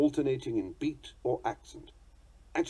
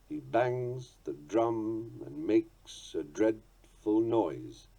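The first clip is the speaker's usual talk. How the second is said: very slow, each beat marked; slow scansion, beats stressed; rising metrical reading; much slower than usual. Shown as a demonstration. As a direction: read "He bangs the drum and makes a dreadful noise" slowly, rising from weak to strong. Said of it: "The line has a rising rhythm".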